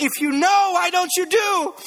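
high, loud, stretched-out mock parental retort; exasperated parent. Dad challenging son who claims he knows, exasperated. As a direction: act out a dad losing patience, pitch climbing.

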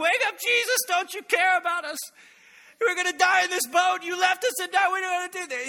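high-pitched, loud panicked shouting; freaking out. Acted. Frantic disciples waking Jesus in the storm, panicked shouting. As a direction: shout desperately to rouse a sleeper, acting out panic. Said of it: "the disciples freak out".

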